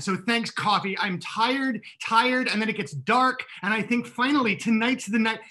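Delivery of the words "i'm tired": ordinary delivery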